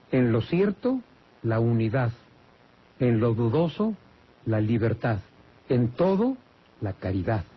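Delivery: phrase-by-phrase dictation with clear pauses; dictado para apuntar. Shown as a demonstration. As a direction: lift each condition, pause, drop firmly on its answer. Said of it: "por si no lo apuntó". (dictado pausado, frase por frase)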